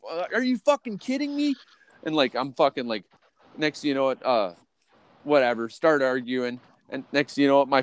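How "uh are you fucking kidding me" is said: high-pitched outraged question; worked-up, imitated protest; retold incredulous anger. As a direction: act out disbelief, voice jumping up. Retelling own outburst in the argument.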